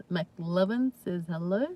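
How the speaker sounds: normal talking voice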